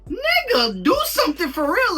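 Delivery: exasperated yell. Real, not acted. Yelling at the movie hero to act, high and loud. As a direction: yell at the screen in frustration. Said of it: "I'm just like".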